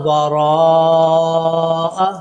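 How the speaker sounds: वराअ held very long, five alifs; long madd. Shown as a demonstration. Teacher demonstrating the great madd. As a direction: stretch the raa of वराअ a long time. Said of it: "रा के ऊपर बड़ी मद है".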